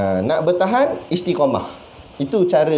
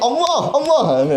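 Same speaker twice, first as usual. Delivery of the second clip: high, drawn-out feigned groan; mock dismay; 8 semitones higher, slower than usual. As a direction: exaggerate the slow, regretful exclamation. Mocks repeated excuse about Monday fast. (keluhan panjang mengejek, nada tinggi)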